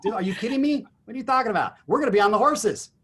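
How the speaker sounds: high-pitched, exuberant disbelief; excited banter. Real, not acted. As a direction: jump in with delighted incredulity. Eagerly joining the planned horse shoot.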